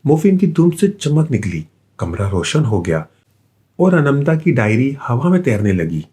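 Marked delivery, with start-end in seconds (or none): none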